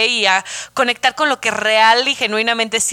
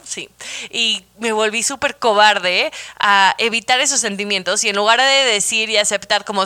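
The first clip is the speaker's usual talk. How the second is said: usual speaking voice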